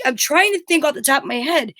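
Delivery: normal talking voice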